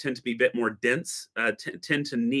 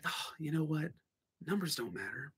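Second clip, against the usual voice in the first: much softer, almost breathed; soft realization; 3 semitones higher, 15 dB quieter than usual. Real, not acted. Coffee brewer telling viewers numbers don't matter, hushed realization. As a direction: let it land gently.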